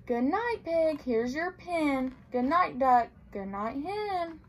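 gentle storybook read-aloud; reading to children. A narrator's reading. Librarian reading a picture book to children, gently.

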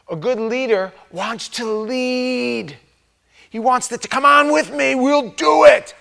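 rallying leader voice. Acted. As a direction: rouse followers to come on. Preacher voicing eager leader.

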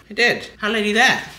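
ordinary talk

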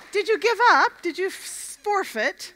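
high, surprised asking; surprised at the losing team; surprised question. Real, not acted. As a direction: ask in disbelief. Surprised at tug result.

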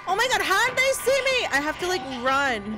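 loud, high, frantic panic; panicked. Real, not acted. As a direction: caught and fleeing in a panic. Spotted, fleeing.

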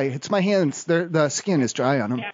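ordinary talk, nothing marked

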